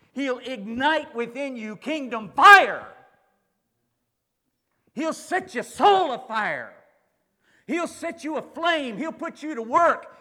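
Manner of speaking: rising, loud preacher's call building up; preaching at full force. Acted.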